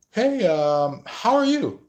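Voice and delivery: high, sing-song greeting; sugary intruder. Retold or imitated. Third party butting in on a date, high and sugary. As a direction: chirp it like a flirty intruder.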